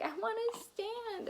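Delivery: put-on baby voice. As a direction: speak for the baby, high and cute. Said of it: "sorry about the mom voice".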